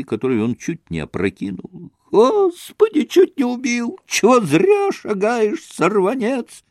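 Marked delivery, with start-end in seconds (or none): 2.1-6.6 high, shrill-voiced old crone scolding; old woman yelping at a passer-by; squealing old woman. A narrator's reading. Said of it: "Раздался пескливый вой какой-то старушонки".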